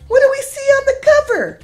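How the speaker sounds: high, fast and eager; excited question. Acted. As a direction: ask the kids brightly. Asking children about cover.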